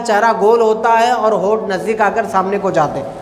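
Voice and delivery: ordinary talk, nothing marked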